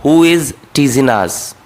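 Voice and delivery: slow, teasing said as teasin; careful teasin without the g; dropped g. Shown as a demonstration. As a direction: drop the g, say teasin. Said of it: "टीजिन तक कहना है".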